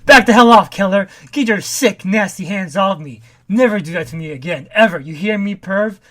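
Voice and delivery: loud, furious retold shout at a creep; angry yell. [name] yelling at Kevin to get off him, angry yell. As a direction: bark him off like he disgusted you.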